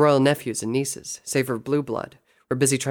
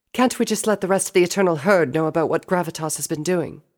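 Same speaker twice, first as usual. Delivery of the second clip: higher-pitched little sister voice; inquiring princess, raised pitch; questioning sister; 6 semitones higher, 5 dB louder than usual. Acted. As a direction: ask big brother, pitched up. Sister pleads in the story.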